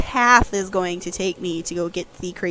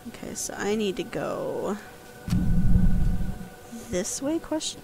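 soft unvoiced aside; whispering; 3 dB quieter than usual. Real, not acted. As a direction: whisper it to yourself.

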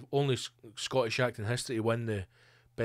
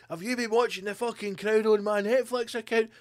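mock interrogation; 8 semitones higher than usual. Prince interrogating wife.

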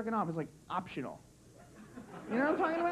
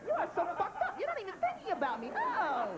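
higher, hurt mock outrage; offended girlfriend; 6 semitones higher than usual. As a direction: scold him like the hurt girlfriend. Acting the girlfriend's anger.

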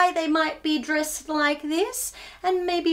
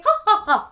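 sung laugh; 6 semitones higher, 3 dB louder than usual. Acted.

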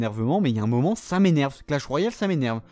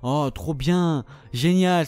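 normal talking voice

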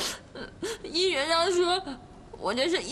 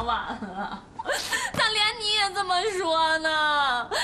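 crying despair. Acted. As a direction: whimper the hopeless words. Caomei breaks into crying.

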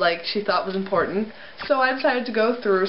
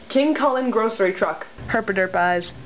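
ordinary delivery

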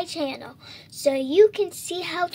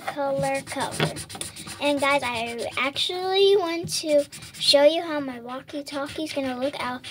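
ordinary delivery

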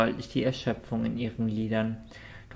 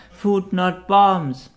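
high, loud and slow, each word spelled out; loud slow call; 7 semitones higher, 8 dB louder, much slower than usual. A narrator's reading. Noti calling the patch slogan back to the counter, loud and slow. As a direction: bellow it across, word by word. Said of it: "rief sie zum Schalter".

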